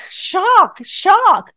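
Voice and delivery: exclaimed high and loud, twice; hammed-up shock; mock shock. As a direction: play up the shock, half-joking. Mock alarm at the news.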